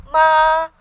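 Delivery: MA high, loud and steady; first tone. Shown as a demonstration. Teacher going through the chart, high steady MA. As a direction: sustain MA evenly up high. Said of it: "high and steady".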